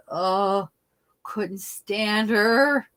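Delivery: ordinary talk, nothing marked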